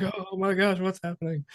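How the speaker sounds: lightly acted anxious viewer; mock suspense. Viewer caught up in the movie's suspense, mock alarm.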